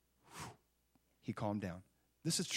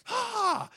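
high-pitched imitated wail; newborn's cry, hammed up; mock baby cry; 5 dB louder than usual. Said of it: "he's just crying".

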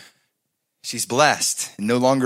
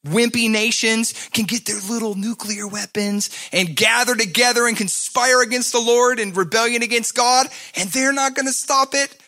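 higher, loud fervent preaching; full-force preaching; 10 semitones higher, 3 dB louder than usual. Acted. Pastor proclaims God's unstoppable plan, fervent. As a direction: preach it with rising fire.

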